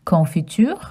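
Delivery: confiture with nasal con and French u; French pronunciation. Shown as a demonstration. Asking children to repeat confiture. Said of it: "Can you say confiture?".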